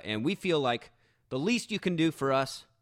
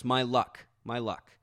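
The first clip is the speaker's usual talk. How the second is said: ordinary talk, nothing marked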